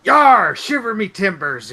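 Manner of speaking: loud, high pirate bellow. Pretend. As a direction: growl it like a swaggering pirate captain. Pirate game banter.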